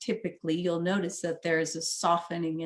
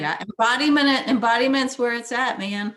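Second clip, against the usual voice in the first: higher, louder enthusiastic declaration; excited; 6 semitones higher, 8 dB louder than usual. Real, not acted. Guest cheerfully declaring her favourite motto, excited.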